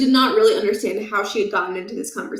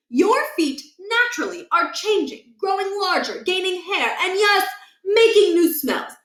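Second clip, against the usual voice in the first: awkward lecturing principal; 9 semitones higher than usual. A narrator's reading. Awkward principal puberty talk.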